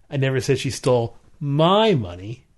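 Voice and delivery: my pushed up, rising; stress on MY. Shown as a demonstration. Demonstrating emphasis on my to a co-host. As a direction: lean on the word my. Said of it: "based on what word you put emphasis on".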